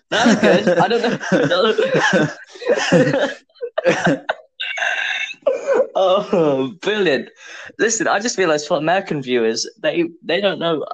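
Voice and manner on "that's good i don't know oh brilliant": real giggling fit breaking into the words; laughing. Real, not acted. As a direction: crack up while talking. Cracking up after own impression.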